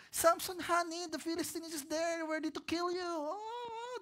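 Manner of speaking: a hammed-up alarm call in a high voice; Delilah calling out. Delilah calls out that the Philistines are here, high mock alarm. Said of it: "sumisigaw na si Delilah".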